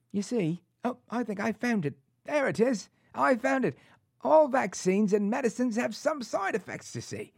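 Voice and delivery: high-pitched, put-on British accent; delighted hammed-up British accent, like an ad mascot. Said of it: "British accent on demand".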